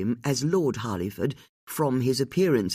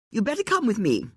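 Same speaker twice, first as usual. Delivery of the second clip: curt lady; 11 semitones higher than usual. A narrator's reading. Lady Harliford, curt, voiced higher.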